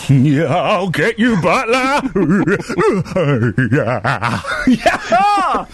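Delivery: grunting, then laughing shout; effort grunt. Pretend. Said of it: "your finest tennis grunt".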